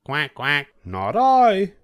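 a duck quacking then refusing. A narrator's reading. Duck refusing to help the hen in a high voice. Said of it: "said the duck".

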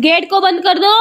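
high, loud shouted request; calling out. Real, not acted. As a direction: shout an instruction across the yard. Calling out to close the gate.

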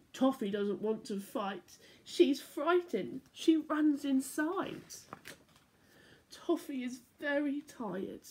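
bright, lilting storybook voice; reading to children. A narrator's reading. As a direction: read it like bedtime story time for little ones. Reading picture book aloud.

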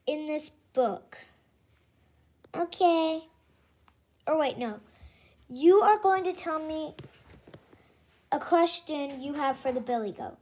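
lightly played teacher voice; pretend teacher. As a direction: take charge of the class. Plays teacher addressing class.

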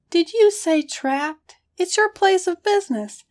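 high, loud, disbelieving protest; incredulous. A narrator's reading. Hunter scoffs at partners' complaint, incredulous. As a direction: sound astonished they would say that. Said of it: "Kramer said incredulously".

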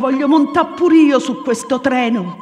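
high, loud Roman pleading cries; desperate shout. Acted. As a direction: shout it, begging to board the train.

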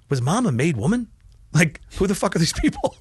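mock disbelief with a laugh; imitating brother. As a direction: play the baffled brother, half-laughing. Brother quoted at funeral.

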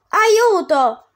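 high, loud cry of Aiuto; shout for help. Acted. Lost Dante calling to a stranger, loud cry. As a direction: call out for help in fear.

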